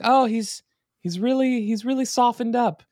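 high, chirpy reply; mock sweet wife. Host voicing the wife cheerfully answering the neighbor, high and chirpy. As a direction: play it up brightly.

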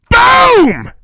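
very high, loud boom; explosion shout. Acted. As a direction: bellow the blast. Explosion sound effect.

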